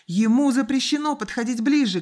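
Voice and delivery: put-on high, loud boyish cry; urgent shouted warning; shouting. A narrator's reading. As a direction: call it out in alarm. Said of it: "крикнул мальчик".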